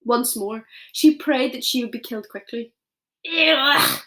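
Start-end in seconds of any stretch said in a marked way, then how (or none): 3.3-4.0 loud retching ugh; a giant gagging on a foul bite; disgusted roar. A narrator's reading. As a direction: spit out a roaring ugh. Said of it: "roared the blood bottler".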